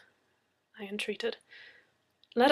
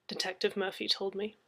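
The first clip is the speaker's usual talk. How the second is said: plain reading voice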